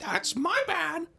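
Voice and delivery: very high, slow mock innocent voice. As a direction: play the sweet oblivious shopper. Playing innocent shoplifter.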